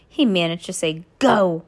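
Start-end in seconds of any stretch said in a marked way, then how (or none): 1.2-1.6 choked, louder furious bursts; strangled voice. A narrator's reading. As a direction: spit out short furious orders. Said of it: "so angry that he could hardly speak".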